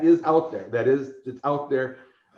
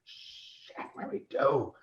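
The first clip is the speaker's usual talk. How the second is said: panicked whisper; 8 dB quieter, slower than usual. Retold or imitated. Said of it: "I'm like".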